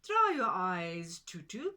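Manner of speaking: loud, kindly Pigeon voice; a hint of a caring bird. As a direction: comfort her firmly as the pigeon. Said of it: "Pigeon said".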